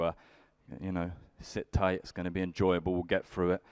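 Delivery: ordinary talk, nothing marked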